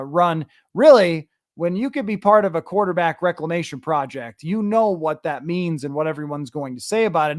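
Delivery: ordinary talk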